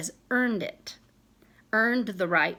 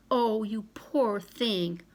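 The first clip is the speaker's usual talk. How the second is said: slow, lightly voiced pitying friend; a slow hint of pity, not overdone; mild mock sympathy; slower than usual. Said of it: "throw something in it like".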